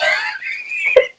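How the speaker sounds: short high-pitched laugh; quick squeal of laughter; real laugh. Real, not acted. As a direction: laugh high at your own muddle. Laughing at confusing herself.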